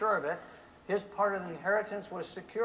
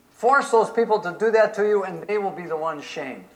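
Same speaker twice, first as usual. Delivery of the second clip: preaching; 9 dB louder than usual. Real, not acted. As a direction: preach it out to the crowd. Preaching at full force.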